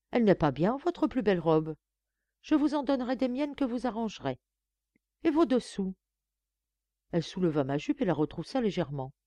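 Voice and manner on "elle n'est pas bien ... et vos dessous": higher, imperious lady of the house; higher-pitched bossy madame; haughty mistress. A narrator's reading. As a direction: talk down to the new maid from on high. Narrator voices Madame.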